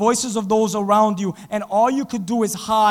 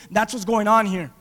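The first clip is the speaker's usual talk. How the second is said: ordinary talk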